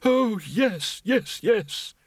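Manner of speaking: put-on high, dreamy old wizard; lost in wonder. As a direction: drift off in delight. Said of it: "lost in wonder".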